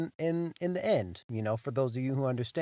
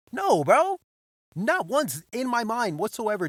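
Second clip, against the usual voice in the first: much higher, loud outburst; emphatic no; 9 semitones higher, 6 dB louder than usual. Real, not acted. Teller insists it never crossed his mind, shouting. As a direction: blurt it out, incredulous. Teller denies he'd ever guess.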